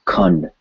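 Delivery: "Khand", tongue tip on the bump behind the teeth for the nd; tongue-behind-ridge N. Shown as a demonstration. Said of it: "tip of the tongue touching right behind the bump".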